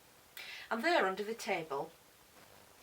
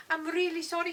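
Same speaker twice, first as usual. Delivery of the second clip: put-on higher-pitched French-accented apology; 10 semitones higher, 6 dB louder than usual. A narrator's reading. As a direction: apologise earnestly as a French boy. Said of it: "sounds French, like my French teacher".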